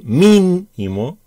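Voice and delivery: "mínimo" with the first syllable MÍN stretched and set apart; stress on MÍN. Shown as a demonstration. Teacher spelling out the accent option, "mínimo" with MÍN stretched. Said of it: "con tilde en la I".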